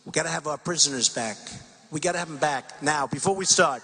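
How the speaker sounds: low, measured acted demand; firm negotiator. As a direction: lay down terms to negotiators, low and even.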